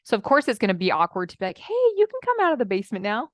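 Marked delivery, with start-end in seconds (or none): 1.6-3.3 high, quick coaxing voice. Pretend. Coach calling her inner child out, coaxing. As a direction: coax a hiding child out, pitched up.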